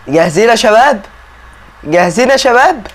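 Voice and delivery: rally the audience before a big reveal; hyped build-up. Real, not acted. Hyping viewers before the big reveal.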